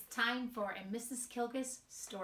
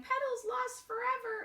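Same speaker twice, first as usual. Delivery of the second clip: mock crying; 14 semitones higher, 4 dB louder than usual. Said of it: "she's crying".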